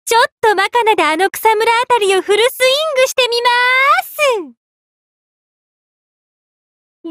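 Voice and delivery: high, rising sing-out; gleeful announcement. Acted. Announcing a full swing at the grass, gleeful.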